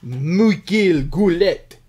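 put-on slow, drawn-out French Canadian accent; mock French Canadian accent with a tacked-on A. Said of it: "Frenching it up there".

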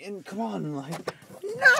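squeaky high cry of protest; a sudden, high-pitched exasperated no; exasperated outburst. Real, not acted. Complaining about bad workday.

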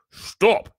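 sharp, high, loud order; commanding. A narrator's reading. Uncle suddenly finds his voice before the giant, commanding. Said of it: "he commanded".

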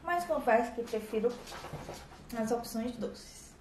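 talking while chewing; speaking between bites; mouth full of bread. Real, not acted.

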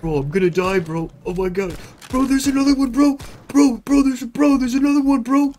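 high panicked yelling; panicking gamer. Real, not acted. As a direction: freak out, voice up high. Monsters attacking in game.

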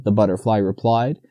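ordinary delivery

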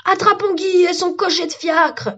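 high-pitched shout to give chase; rally the mob after fugitives; mob cry. Acted. Tree mob repeats its cry.

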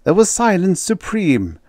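usual speaking voice

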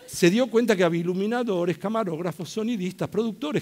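normal talking voice

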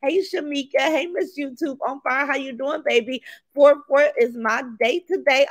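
usual speaking voice

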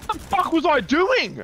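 high, loud disbelieving shout; high furious yell. Real, not acted. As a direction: scream at the broken game. Raging at death.

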